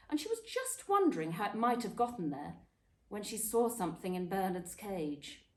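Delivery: ordinary delivery, nothing marked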